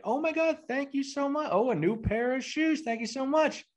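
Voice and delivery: put-on high-pitched baby voice; put-on high toddler thank-you voice; Wesley voice. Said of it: "in the Wesley voice".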